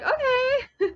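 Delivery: high squeaky relieved okay. Retold or imitated. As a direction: pitch it way up in relief. Relief, cat just sleeping.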